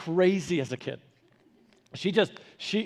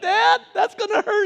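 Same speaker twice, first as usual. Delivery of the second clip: put-on whining kid; 13 semitones higher, 4 dB louder than usual. Child pleading with dad.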